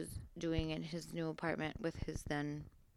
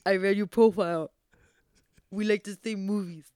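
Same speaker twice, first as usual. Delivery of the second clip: put-on mock suitor voice; imitating his voice; 3 dB louder than usual. Woman doing the man's voice on request, mocking. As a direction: parody his cheesy pickup delivery. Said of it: "Do the, do my voice".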